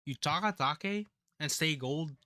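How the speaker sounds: normal talking voice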